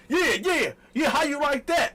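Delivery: high, loud mocking taunt; gleeful loud taunting; taunting shout. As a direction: jab the words at her, loud and high. Repeating the taunt.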